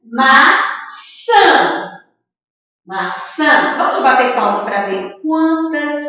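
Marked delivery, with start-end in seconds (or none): 0.0-2.0 "maçã" split into two strong beats, ma... çã; slow two-part "maçã"; syllable by syllable. Shown as a demonstration. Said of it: "só vai separar a palavrinha". 2.8-3.8 "Maçã" in two short syllables, ma, çã; "Maçã" lower, split in two. Shown as a demonstration. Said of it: "só vai separar a palavrinha".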